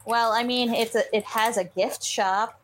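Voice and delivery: ordinary talk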